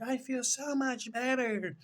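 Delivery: mock relieved bride. Depressed bride after bath.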